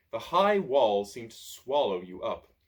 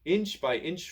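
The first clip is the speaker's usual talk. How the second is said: ordinary delivery, nothing marked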